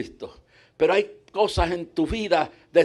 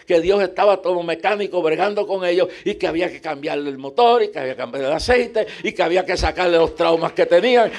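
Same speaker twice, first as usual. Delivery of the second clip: ordinary delivery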